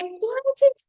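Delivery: exasperated why. Acted. Coach pleading with doubting viewers, exasperated. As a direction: throw up your hands and plead.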